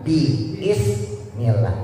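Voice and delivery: "bi ... ismillah" with a pause after bi, slow; "bi" then "ismillah" drawn out slowly as two words; split bi ismillah. Shown as a demonstration. Teacher spelling out "bi ismillah" slowly to students. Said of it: "Tulisan aslinya".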